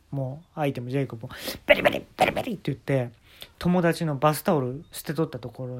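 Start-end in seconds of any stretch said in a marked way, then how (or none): 1.7-2.5 imitated rip. Tearing sound imitated.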